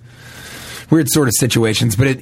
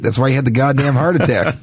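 ordinary delivery

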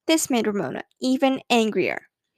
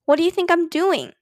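put-on indignant child, pitched higher; mildly raised, higher-pitched protest; 4 semitones higher than usual. A narrator's reading. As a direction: throw it back at a parent, voice going up. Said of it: "Ramona raised her voice".

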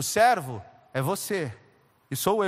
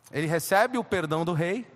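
usual speaking voice

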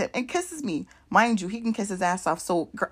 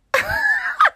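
loud high laugh; 4 dB louder than usual. Real, not acted. Laughing out loud at the party. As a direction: burst of high-pitched laughter.

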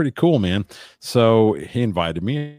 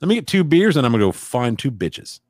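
excited; 10 semitones higher, 4 dB louder than usual. Retold or imitated. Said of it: "And I was like".

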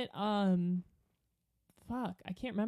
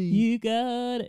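slow, loud drawling singer impression; croaked-out parody of a songwriter; 8 dB louder, slower than usual. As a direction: stretch the words like a mock singer. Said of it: "It's almost a [name]".

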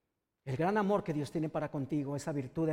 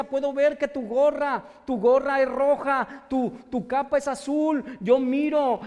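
slow, loud, higher voice full of wonder; amazed wonder; 7 semitones higher, 9 dB louder, slower than usual. Acted. Healed man tells strangers their colors, amazed and loud. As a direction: marvel at every color you see.

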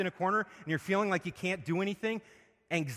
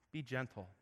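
Be gentle, said much softer and lower; 3 semitones lower, 10 dB quieter than usual. Shown as a demonstration. Preacher showing Paul's advice to listeners, soft and gentle. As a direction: let it fall away softly, as if showing gentleness. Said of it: "And what is his comment?".

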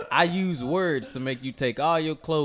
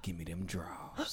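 menacing robber; 11 semitones lower, 16 dB quieter than usual. Acted. Plays robber demanding the underwear.

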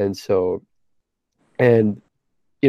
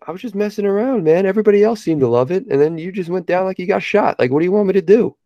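high-pitched retold protest; imitating your own exasperated excuse, high and insistent; exasperated self-defence; 8 semitones higher than usual. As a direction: plead your case to a kid, pitched way up. Retold self-defence to upset kid.

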